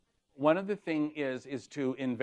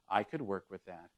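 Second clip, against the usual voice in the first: lower, softer, deadpan; straight face; 6 semitones lower, 10 dB quieter than usual. Shown as a demonstration. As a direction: say it flat and calm as if you mean it. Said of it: "say with a straight face".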